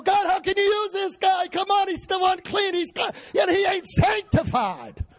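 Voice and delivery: high shouted mock complaint to God; indignant young preacher. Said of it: "And I go".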